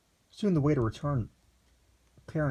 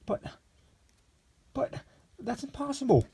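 high, aghast stammer. A narrator's reading. Shocked Lexington protesting to the waiter, stammering high. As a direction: stumble on the first word in shock. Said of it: "the eu-, youth stammered".

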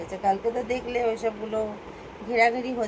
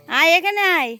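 calling out; 7 semitones higher, 9 dB louder than usual. Real, not acted. Calling daughter over from afar.

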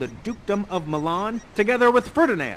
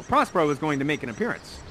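ordinary talk, nothing marked

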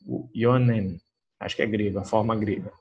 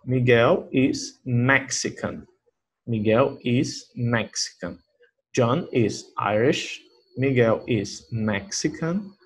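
slow, careful teacher read; slow model reading; much slower than usual. Shown as a demonstration. Teacher reading example sentences slowly for students. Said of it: "reading examples for students".

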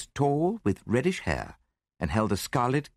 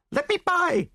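very high, panicked woman's shriek; 4 dB louder than usual. A narrator's reading. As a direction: shriek to get past. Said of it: "she shrieked".

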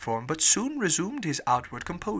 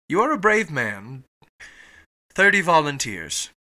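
loud, emphatic declaration; calling to soldiers; 6 dB louder than usual. A narrator's reading. Captain praises Fogg, then calls soldiers, loud. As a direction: project it like an officer's order. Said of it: "turning to the soldiers".

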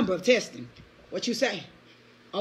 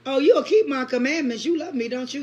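ordinary talk, nothing marked

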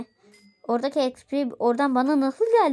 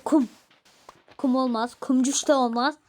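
ordinary talk

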